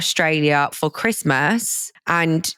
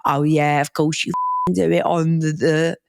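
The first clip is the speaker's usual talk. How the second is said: put-on Cockney accent. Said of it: "in his Cockney accent".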